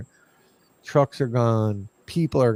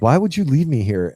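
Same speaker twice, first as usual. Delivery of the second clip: mildly raised, higher voice, half a yell; retold yell; 4 semitones higher, 5 dB louder than usual. Yelling at the crew who left him behind, mock angry. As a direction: replay the frustrated shout at the crew. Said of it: "So I'm yelling at 'em".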